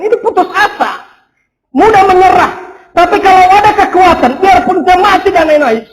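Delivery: loud, high, driving sermon voice; fervent preaching. Acted. Pastor urging the congregation, loud fervent preaching. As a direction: preach it out to the whole church.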